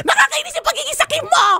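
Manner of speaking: mock anger. Host scolds caller Carla, laughing mock fury. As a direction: pretend to be furious while laughing.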